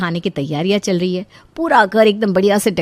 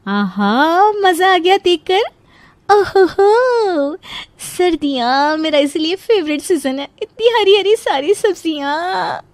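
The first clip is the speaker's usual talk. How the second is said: high, drawn-out savouring voice; blissfully pleased, pitched well up; delighted daughter-in-law; 9 semitones higher, slower than usual. Acted. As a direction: revel in it, stretching the exclamations.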